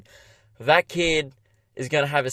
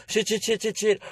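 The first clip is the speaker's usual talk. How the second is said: high, breathless string of curses; panicked swearing; 8 semitones higher than usual. Retold or imitated. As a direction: blurt it in a rising panic. Sees man reach the baby.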